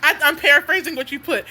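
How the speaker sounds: ordinary talk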